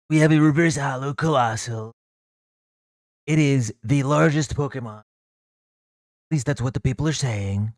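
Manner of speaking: lightly voiced Trump-style boast; mock Trump impression. Streamer boasting to chat about the largest Pokemon, mock Trump voice. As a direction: brag like a politician at a rally, half-joking.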